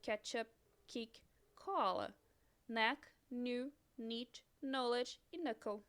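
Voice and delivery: each English word said clearly with pauses; teacher reading a vocabulary list in English; English model words. Shown as a demonstration. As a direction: model the English pronunciation for repeating. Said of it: "pode ser silenciosa".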